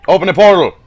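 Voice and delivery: loud, high mock order; shouted command. Player ordering the gate opened, shouted command. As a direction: bellow it at the gate.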